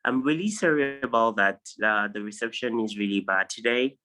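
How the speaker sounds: ordinary delivery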